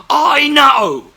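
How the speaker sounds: sudden loud, high burst of frustration; exasperated shout. Real, not acted. Host shouting back at critics who call it acting, exasperated. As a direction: blurt it out, fed up with being told.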